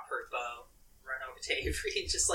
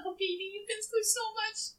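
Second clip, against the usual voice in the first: tender; 3 dB quieter than usual. Acted. Comforting friend after near death.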